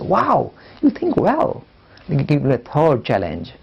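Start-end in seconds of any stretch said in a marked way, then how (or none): 0.0-1.6 slow, higher, awed friend; drawn-out admiring wow from a classmate; impressed friend. Retold or imitated. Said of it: "they say".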